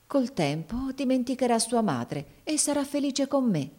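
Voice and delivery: reassuring oneself to ease a conscience; self-reassuring. A narrator's reading. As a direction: talk yourself into believing it. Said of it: "disse per calmare la sua coscienza".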